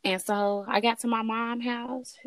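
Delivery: normal talking voice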